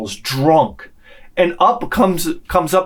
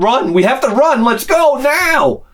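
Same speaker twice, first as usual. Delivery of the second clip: mock panic climbing higher; hammed-up high alarm; urgent warning; 8 semitones higher than usual. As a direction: urge them to flee, stretching the last words. Imagined urgent warning to flee.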